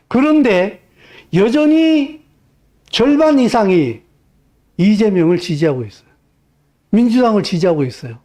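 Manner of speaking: high, emphatic sermon lines; fiery preaching. Acted. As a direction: drive each phrase home from the pulpit. Preaching burst.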